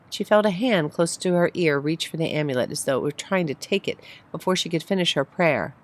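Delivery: usual speaking voice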